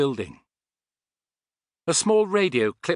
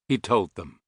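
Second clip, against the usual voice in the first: usual speaking voice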